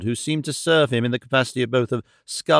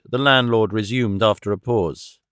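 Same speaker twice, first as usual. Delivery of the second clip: ordinary delivery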